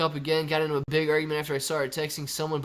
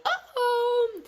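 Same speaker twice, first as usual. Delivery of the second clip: squeaky uh-oh; 3 dB louder than usual. Pretend. Mock alarm in gremlin voice.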